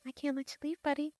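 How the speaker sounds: soft, high, eerie calm threat; creepy robot menace. Retold or imitated. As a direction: coo it like a sinister machine. Creepy robot keeping them inside.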